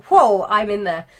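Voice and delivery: loud, eager whoa; eager jump in. Real, not acted. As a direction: dive straight into the debate. Jumping into an online argument.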